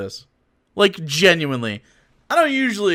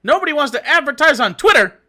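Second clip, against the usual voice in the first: incredulous; 9 semitones higher, 4 dB louder than usual. Real, not acted. Incredulous repeat.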